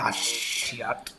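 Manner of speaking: whispered under his breath; whispered mild curse; muttered curse. Retold or imitated. Learns he must fight to get on.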